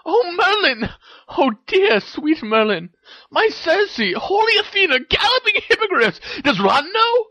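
squealing string of high exclamations; astonished, delighted Hermione. A narrator's reading. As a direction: gush in a very high, thrilled voice.

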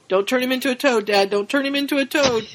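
put-on fast, high pleading boy. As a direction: beg dad quickly, half panicked. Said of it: "Alf's like".

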